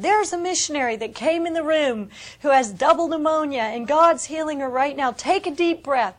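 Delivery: loud, high proclamation from the stage; ringing conference-stage announcement; retold preacher call-out. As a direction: boom it out like a revival preacher. Retold speaker calling out healing.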